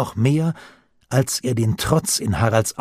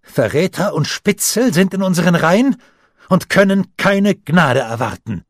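higher and louder, a hard vow; fierce; 8 semitones higher, 5 dB louder than usual. A narrator's reading. Harald to Oskar, fiercely vowing no mercy. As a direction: spit out the threat against traitors. Boy vows revenge on traitors.

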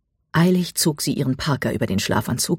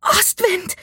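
very high screamed name; high, far-carrying desperate call. A narrator's reading. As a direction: scream out for a lost horse. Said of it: "schrie Mika so laut sie konnte".

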